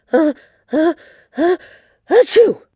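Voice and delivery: high, loud build-up to a sneeze; stagy sneeze. Acted. Queen interrupted while greeting the girls, stagy sneeze. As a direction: gasp up into a big achoo. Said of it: "sneezed Queen Moo".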